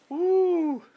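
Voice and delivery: high delighted whoop with a laugh; joyful whoop. Real, not acted. Preacher whooping with joy at the angel's arrival. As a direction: let out a thrilled woo.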